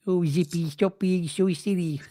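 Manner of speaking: slow, high puppet voice; George. Acted. George telling Zippy off, slow high puppet voice. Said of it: "what would George say?".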